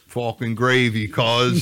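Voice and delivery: slow, loud Italian-American tough guy; paisan gravy boast. Retold or imitated. A comic imitating an Italian-American heckler, slow and loud. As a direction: drag out "Fucking gravy, cuz" like a proud paisan. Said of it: "one paisan who's like".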